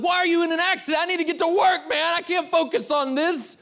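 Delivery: high, loud, rushed tirade; exasperated shouting rant. Acted. Late commuter yelling at a wrecked truck, exasperated shout. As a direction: yell at the driver in mock fury.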